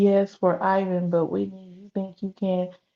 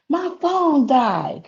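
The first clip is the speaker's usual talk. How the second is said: real laughing complaint, drawn out and higher; 5 semitones higher, 3 dB louder, much slower than usual. Real, not acted. Vlogger back on after the phone died, laughing. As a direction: laugh through a slow, raised-pitch complaint.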